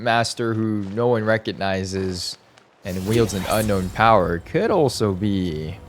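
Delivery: usual speaking voice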